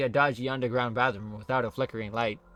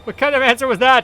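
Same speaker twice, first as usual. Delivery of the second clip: exasperated yell; 12 semitones higher, 13 dB louder than usual. Real, not acted. Exasperated reaction to a game line.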